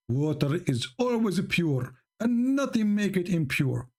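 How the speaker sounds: usual speaking voice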